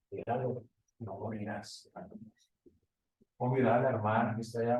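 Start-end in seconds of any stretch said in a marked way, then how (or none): 0.1-1.9 soft, sly tempting devil. Retold or imitated. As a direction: tempt him quietly, like the devil. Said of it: "Y viene el diablo y dice". (el diablo tentando en voz baja)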